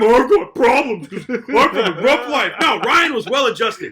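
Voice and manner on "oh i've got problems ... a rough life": slow, louder, laughing parody of self-pity; mock whiny complaint. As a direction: sneer at a character's self-pity. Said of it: "You don't see him".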